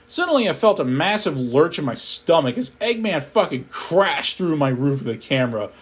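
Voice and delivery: ordinary delivery, nothing marked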